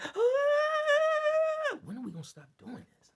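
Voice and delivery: high whining cry, then fast complaint; mock the whiners who turn on him; mocking whiny critics. Imitating critics who complain once he speaks up, hammed-up whine. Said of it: "then it's".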